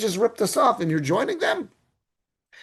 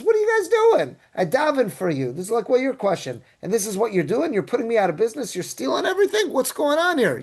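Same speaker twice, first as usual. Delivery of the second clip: a high, indignant "what is going on" as the Kohen; the robbed priest protesting, jumping high in mock outrage; shocked protest; 6 semitones higher than usual. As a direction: squeal it out in disbelief, pitch way up. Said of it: "And that Kohen says".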